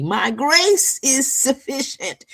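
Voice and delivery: quoting God gently to his daughter; God speaking. Retold or imitated. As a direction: lift it up and slow it down as God's promise. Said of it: "That's what he would say to me".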